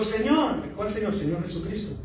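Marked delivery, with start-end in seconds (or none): 0.0-0.6 trembling, fearful. Retold or imitated. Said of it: "Temblando y temeroso dijo".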